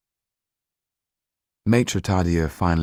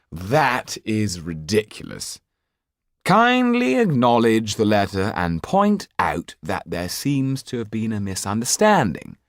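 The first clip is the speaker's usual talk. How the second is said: higher, slow lofty dismissal; haughty Marquis; 4 semitones higher, 3 dB louder than usual. Acted. Marquis ordering the lawyer to reply, haughty and slow. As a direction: wave away the debt with contempt.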